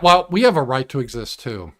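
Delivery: ordinary delivery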